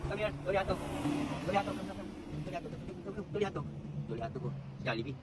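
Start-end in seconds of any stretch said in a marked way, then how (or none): 0.1-3.7 higher coaxing call to come; calling a dog. Real, not acted. Owner calling the dog to come. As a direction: coax the dog over, pitched up.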